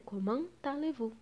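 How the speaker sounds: "Comment allez-vous" softly, linked by a T sound; T liaison. Shown as a demonstration. Teacher demonstrating the liaison to students, linking T in "Comment allez-vous". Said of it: "vai ficar com som de T".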